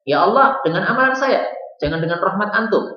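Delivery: high-pitched, argumentative protest; stubborn insistence. Retold or imitated. Stubborn servant arguing with Allah over his deeds, insistent. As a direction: imitate someone stubbornly arguing back. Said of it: "Orang tadi masih ngeyel ya".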